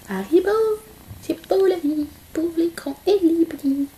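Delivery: high, sing-song voice for the pet. Real, not acted. Greeting her pet Haribo after filming, high baby talk.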